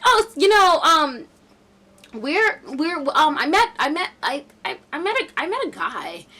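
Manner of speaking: high, loud, stammering imitation; imitated awkward confession. Brooklyn admitting to friends she met a guy, stammering. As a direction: stumble over the admission, restarting repeatedly.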